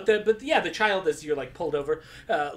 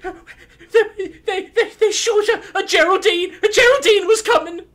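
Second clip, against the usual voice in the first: high, loud, frantic calling; panicked child; 13 semitones higher, 7 dB louder than usual. Acted. The child frantically calls Uncle Hedge, high and panicked.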